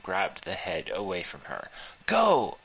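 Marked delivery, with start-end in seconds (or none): 2.1-2.6 loud, high shout of go. Acted. Narrator yells at the others to flee the flames. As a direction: yell at them to run.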